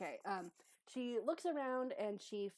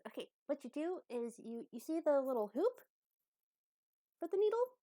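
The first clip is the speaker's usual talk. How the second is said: rising, higher and louder instructor voice; coaxing teacher character; 7 semitones higher, 6 dB louder than usual. Acted. [name] shows Croak the needle's hoop, coaxing. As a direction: check the pupil follows, pitching up.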